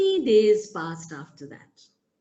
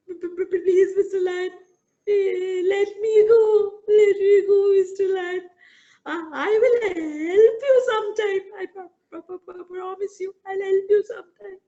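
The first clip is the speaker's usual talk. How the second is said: much higher, stuttering, crying mouse voice; frightened mouse promising to help the lion; 9 semitones higher, 4 dB louder than usual. Acted. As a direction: beg through tears, tiny and shaky.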